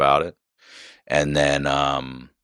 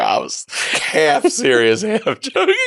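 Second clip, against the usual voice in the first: laughing through the words, pitched up; 11 semitones higher than usual. Real, not acted. Laughing as he admits he was half joking to the doctor. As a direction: giggle it out, half embarrassed.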